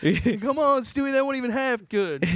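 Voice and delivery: high-pitched scolding whine; mock talent contest. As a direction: overact an exasperated put-down. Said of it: "It's just [name]'s, like, drunk".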